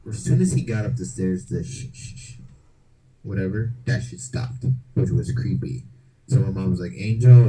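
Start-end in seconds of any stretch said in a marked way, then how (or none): 1.6-2.3 quick shushing scrape; imitated tracking noise. The noise as brother got up the stairs, imitated shushing. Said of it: "this weird tracking noise". 6.9-7.5 loud imitated mother's call. Mom checking on Angel from downstairs, calling out loudly.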